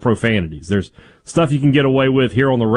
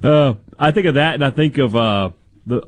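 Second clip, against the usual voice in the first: ordinary talk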